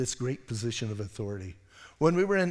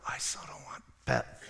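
imitating a nervous whisper; scared whisper; 5 dB quieter than usual. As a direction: hush it like you fear getting caught. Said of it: "everybody's going".

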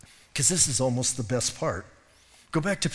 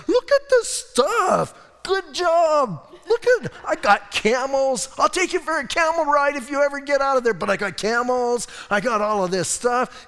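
much higher, louder, giddy show-off voice; high, excited boasting husband; gloating Abraham; 9 semitones higher, 5 dB louder than usual. Retold or imitated. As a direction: brag like a kid with new toys. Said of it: "He's, like, all stoked about it".

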